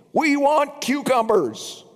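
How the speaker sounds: put-on high, childish whine; mock the crowd's griping; whiny demand. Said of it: "they still kept on complaining".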